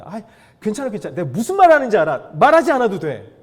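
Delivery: loud, cutting in with "I get it"; a loud, pushy mock reassurance; dismissive know-it-all. Mind-reading listener cuts speaker off.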